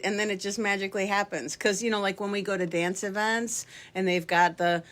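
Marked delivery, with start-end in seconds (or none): none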